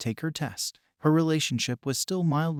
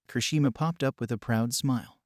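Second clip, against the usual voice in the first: ordinary delivery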